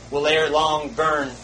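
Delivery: normal talking voice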